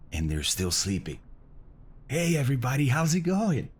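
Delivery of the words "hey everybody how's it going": ordinary talk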